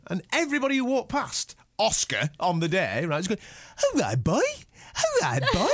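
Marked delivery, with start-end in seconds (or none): 3.9-5.7 mock dog-owner cooing; silly pet-greeting voice; talking to dog. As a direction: lift it like greeting a pup. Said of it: "Grown men reduced to going".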